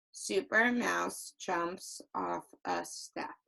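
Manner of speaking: slow, flat, bored reading; boring, flat voice. Shown as a demonstration. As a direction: drone it word by word. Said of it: "that's a boring, flat voice".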